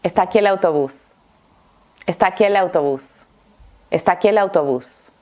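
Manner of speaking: ESTÁ AQUÍ EL AUTOBÚS run together as "estakielautobús"; quick connected speech, vowels merged; très rapide. Shown as a demonstration. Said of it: "Lo voy a decir muy rápido".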